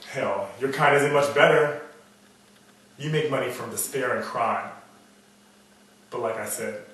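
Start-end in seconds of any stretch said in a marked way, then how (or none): 0.1-4.7 contemptuous snarl. Acted. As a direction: lash out at the listener. Turns on the listener with contempt.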